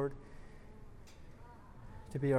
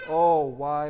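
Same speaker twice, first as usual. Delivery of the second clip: loud wail of regret; narrator's loud lamenting outburst; anguished lament; 8 dB louder than usual. A narrator's reading. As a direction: cry it out in dramatic regret. Climax of damned man's lament.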